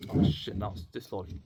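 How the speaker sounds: normal talking voice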